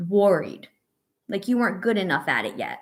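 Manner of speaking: ordinary delivery, nothing marked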